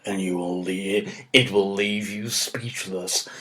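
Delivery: film trailer voice. Shown as a demonstration. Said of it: "a bit lower than that".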